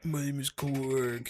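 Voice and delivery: slow, dopey rock creature voice; dumb rock voice. Retold or imitated. Mocking the director's rock guy, slow dopey voice.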